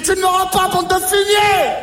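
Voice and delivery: beaucoup plus aigu et fort; cri furieux. Acted. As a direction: insulter l'extraterrestre à travers la porte. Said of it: "J'ai dit". (cri furieux et aigu)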